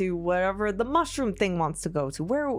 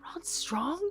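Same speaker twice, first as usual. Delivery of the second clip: whispered sulk; 5 semitones higher, 9 dB quieter than usual. Acted. Sulking half-orc mutters.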